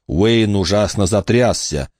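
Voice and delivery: ordinary delivery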